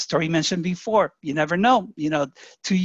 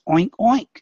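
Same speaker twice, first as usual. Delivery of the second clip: louder, playful oink oink; mock pig oink; 3 semitones higher, 5 dB louder than usual. Voicing the pig in the farmhouse fable, louder mock oink. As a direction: grunt it out like a farm pig. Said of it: "the pig says".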